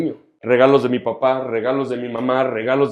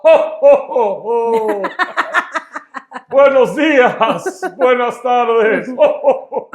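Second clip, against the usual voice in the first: loud, high, drawn-out mock Santa hello; playful Santa greeting; 10 semitones higher, 5 dB louder, much slower than usual. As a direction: boom out festive greetings broken by ho-ho laughs. Santa-style greetings opening the show.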